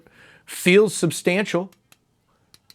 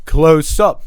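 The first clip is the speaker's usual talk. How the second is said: much louder, right on the mic; close to mic; 7 dB louder than usual. Shown as a demonstration. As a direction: lean in close.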